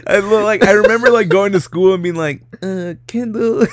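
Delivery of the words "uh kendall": high, slow, hesitant mock-timid call; timid approach. Scared kid approaching the bully at school, mock timid. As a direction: imitate a scared kid tentatively getting someone's attention. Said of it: "I was just too scared".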